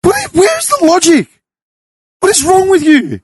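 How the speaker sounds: ranting to friends about an illogical driver; indignant rant. Real, not acted. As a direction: vent the disbelief at the driver's logic.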